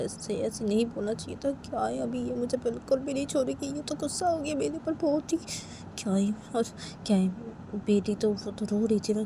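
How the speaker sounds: soft, high fretting to himself; worried father muttering. Acted. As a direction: regret it anxiously under your breath. (घबराकर खुद से बुदबुदाते पापा)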